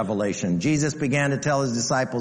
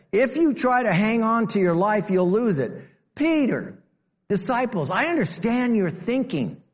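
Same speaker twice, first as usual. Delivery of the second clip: preaching climax; 10 semitones higher than usual. Acted.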